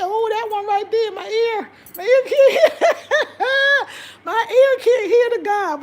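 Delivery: high, loud laughing protest; words pushed out through high laughter. Real, not acted. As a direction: crack up while talking. Laughing over not hearing the language.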